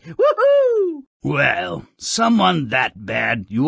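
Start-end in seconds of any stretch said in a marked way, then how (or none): 0.1-1.0 high, loud whoop of delight; high ecstatic whoop; gleeful whoop. Acted. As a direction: whoop like a fan at a brawl. Host cheers the battle royal.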